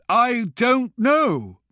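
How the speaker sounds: high, slow, hesitant monk. A narrator's reading. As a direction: answer slowly, unsure. The monk replies.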